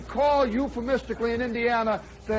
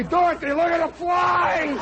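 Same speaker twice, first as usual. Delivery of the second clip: louder, higher blurted warning, slowing down; mock blurted warning; 7 semitones higher, 3 dB louder than usual. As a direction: blurt out the awful truth. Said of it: "Do you say".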